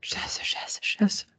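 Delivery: hushed, breathless whispered swearing; whispered curse. Acted. Acting out the morning the podcast drops, hushed whispered panic. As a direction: mutter it under your breath in mock shock.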